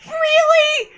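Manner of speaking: stunned high-pitched "Really?"; incredulous squeal. Real, not acted. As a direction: squeak it out in disbelief. Game blocks the dating option.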